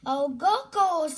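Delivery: loud, high urgent call. A narrator's reading. Polly calling out as she rushes off, loud. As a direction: hurry her on, out loud. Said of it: "calls Polly".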